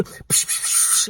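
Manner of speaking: hissed imitation of a spray bottle; long soft bursts of psh; spray noises. Pub worker mocking how they spray tables, hissed spray noises.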